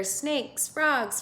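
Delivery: ordinary talk, nothing marked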